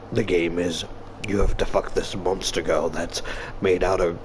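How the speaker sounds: very low, soft menacing voice; sinister game-master villain. Acted. Villain explains a deadly game to a victim, sinister menace. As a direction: deliver the rules like a horror villain, low and hushed.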